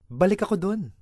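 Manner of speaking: normal talking voice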